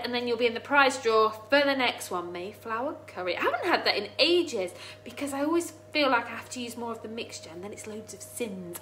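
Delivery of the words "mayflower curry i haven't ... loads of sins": usual speaking voice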